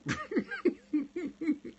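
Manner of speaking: real squealing laugh; high burst of laughter. Real, not acted. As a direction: crack up high. Laughs at own whisper.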